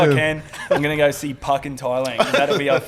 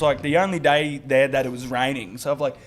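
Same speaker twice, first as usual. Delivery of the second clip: normal talking voice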